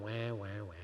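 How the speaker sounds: gentle self-teasing, wah fading soft; mock crybaby wah. Preacher teasing himself for whining, soft mock wah. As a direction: scold yourself with a lazy wail. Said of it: "say those three words to yourself".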